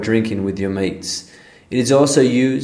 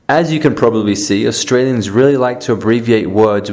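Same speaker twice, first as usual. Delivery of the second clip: ordinary talk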